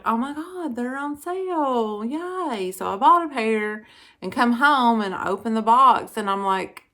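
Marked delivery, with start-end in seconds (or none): 0.0-2.7 higher-pitched, excited retelling at usual volume; mildly mock squeal of delight; retold excitement. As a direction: relive the thrill of a find, pitched up. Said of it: "I got so excited".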